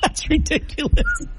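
high, disbelieving laugh. Real, not acted. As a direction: laugh at how absurd it is. Reacting to the record nose whistle.

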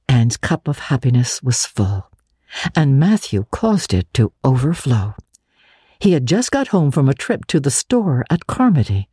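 plain reading voice

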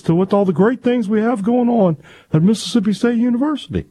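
speechify like a proud college president; Mark Keenum impression. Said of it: "requesting a Mark Keenum impersonation".

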